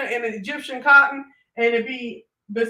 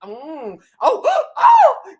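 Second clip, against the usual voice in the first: mock shocked gossip reaction; 5 semitones higher, 6 dB louder, much slower than usual. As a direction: play the eager gossip, each oh more scandalized. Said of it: "I'll be up here like".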